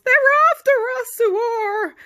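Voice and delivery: high, loud, fast yell; panicked yell. Acted. Pirates yelling as rivals chase them. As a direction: yell it while running.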